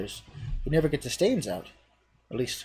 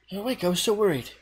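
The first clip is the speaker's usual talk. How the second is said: higher, relieved; relieved friend; 6 semitones higher, faster than usual. Acted. Viola to the hero, higher relieved greeting. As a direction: rush in with relief.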